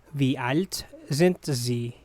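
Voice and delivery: careful, spaced-out teaching model. Shown as a demonstration. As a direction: break it up for learners. Teacher repeats slowly for learners.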